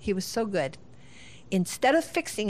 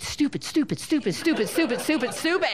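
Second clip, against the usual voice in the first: rapid-fire, higher mock outburst; punchy repeated blurts, louder on each hit; venting frustration; 6 semitones higher, 3 dB louder than usual. Parent venting what was held in, exasperated. Acting out their hidden frustration.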